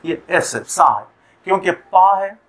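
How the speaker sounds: ordinary talk, nothing marked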